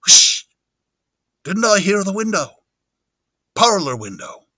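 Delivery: nasal, stuffy character voice; Teddy Henry. Acted. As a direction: talk as if blocked up with a cold. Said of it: "almost stuffy, like he's got a cold".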